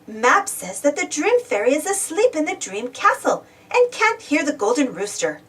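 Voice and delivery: plain reading voice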